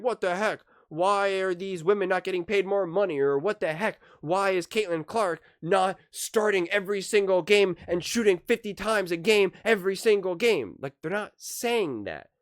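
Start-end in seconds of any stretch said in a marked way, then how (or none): 0.0-10.8 higher, louder imitation of fans freaking out; imagined angry fan shouting about pay; mock fan outrage. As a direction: play up a hypothetical outraged fan. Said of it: "freaking out and saying".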